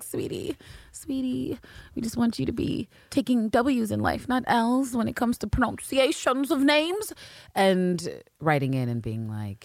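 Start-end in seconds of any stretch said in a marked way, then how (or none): 0.0-7.1 chiding listener. Retold or imitated. A listener writes in to correct the host, high-pitched and coaxing. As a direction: coax them in a high, put-on voice. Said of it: "One of the people was like".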